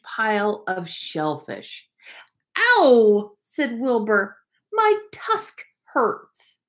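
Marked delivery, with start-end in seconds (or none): 2.6-3.4 high, loud yelp of pain; a sudden hurt little cry; young walrus yelping in pain. Acted. Wilbur hurts his tusk. 4.7-6.6 much higher, slow whimpering complaint; young walrus whimpering that his tusk hurts; whiny little walrus. Acted.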